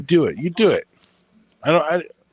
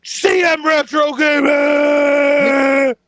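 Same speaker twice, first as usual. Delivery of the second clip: much higher, loud ring-announcer shout; wrestling intro; 6 dB louder than usual. Retold or imitated. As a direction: hype him up like a wrestling announcer. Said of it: "If I was cutting a freaking wrestling intro on you, I'd be like".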